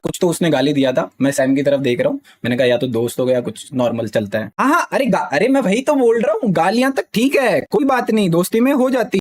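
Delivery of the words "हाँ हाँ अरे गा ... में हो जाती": heated protest. Real, not acted. Heated reply to chat.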